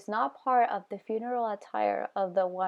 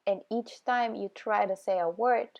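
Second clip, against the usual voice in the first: usual speaking voice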